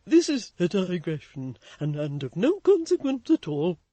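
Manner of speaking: loud, abrupt brush-off. A narrator's reading. Toots abruptly dropping the subject with Florence. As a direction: cut himself short. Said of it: "said Mister Toots abruptly".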